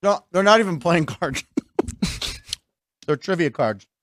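talking through a mouthful of chocolate; eating chocolate. Real, not acted. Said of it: "Now I have chocolate in my mouth".

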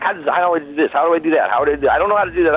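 rushed, flustered self-quote; acted-out frustration. Musician recounting struggles alone with Bandcamp, mock frustrated. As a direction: rattle it off as if mildly exasperated. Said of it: "I get so frustrated".

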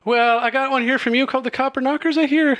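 high, quick showy female voice; Kalora speaking. Acted. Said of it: "and she says".

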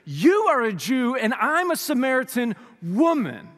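loud, high preaching read; scripture reading. Acted. Preacher reading John four aloud, proclaiming. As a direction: proclaim the Bible passage to the congregation.

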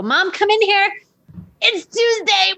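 put-on high little kid's voice; child voice. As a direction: play the small son, high and eager. Mother voicing her little son.

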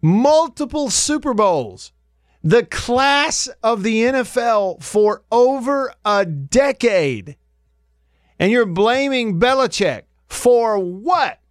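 slow, high-pitched disbelief; indignant, stretched-out rhetorical questions; incredulous. Real, not acted. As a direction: draw it out in high, baffled outrage. Incredulous rant at the caller's blame.

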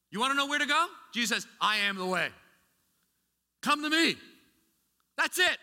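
high, loud preaching call; full-force preaching. Acted. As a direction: preach it out to the congregation.

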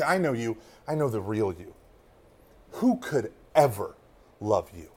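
low, soft and cold, dropped right down; hushed accusation. Pretend. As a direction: lay someone's secret bare in a quiet voice. Imagined person exposing you.

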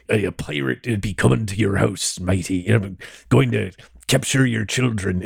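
lightly voiced old British sea dog pirate; a hint of a salty British pirate growl; mild British pirate accent, menacing kids in jest. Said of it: "like, old British sea dog voice".

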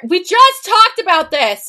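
high, loud protest at the story; exasperated outburst. Acted. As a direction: raise your voice in disbelief. Reader protests at the repetitive plot.

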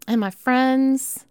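loud, drawn-out call to the listeners. Acted. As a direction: stretch out my friends high and loud. Calling out to listeners.

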